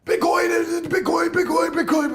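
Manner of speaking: high, shouted mock crypto guy yelling Bitcoin. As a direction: yell it like a hyped influencer. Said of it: "one guy who yells all the time".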